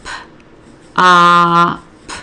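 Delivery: finger-spell "pop" sound by sound with gaps between; segmented sounds. Shown as a demonstration. Tutor modelling say and write pop, each sound segmented. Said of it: "They need to hear it segmented".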